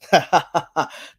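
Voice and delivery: hammed-up forced laugh; a deliberately cheesy laugh; corny fake laugh. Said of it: "that was a corny ass laugh".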